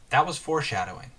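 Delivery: ordinary delivery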